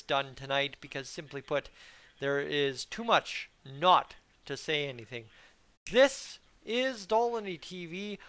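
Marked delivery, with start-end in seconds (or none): 5.9-8.2 show sign-on. Acted. Channel intro sign-on.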